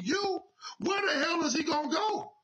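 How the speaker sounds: indignant cry of disbelief that tips into laughter; outraged shout. Real, not acted. Ranting to Yvette about HBCU hiring, an outraged laughing shout.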